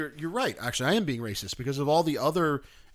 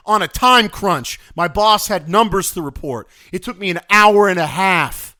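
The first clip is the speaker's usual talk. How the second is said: loud, higher, frustrated complaint; exasperated rant; 4 semitones higher, 9 dB louder than usual. Real, not acted. Worker with boss waiting for numbers, exasperated rant. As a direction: rant at full volume about wasted time. Ranting on about lost time.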